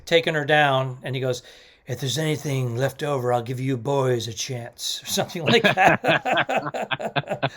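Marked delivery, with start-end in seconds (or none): 1.9-5.0 villain quote. Retold or imitated. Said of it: "I'm paraphrasing".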